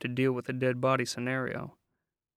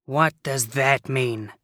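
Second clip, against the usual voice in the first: slow, louder, gruff demand; 5 dB louder, slower than usual. Acted. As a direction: demand it like James Cagney. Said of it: "demanded McQueen finally".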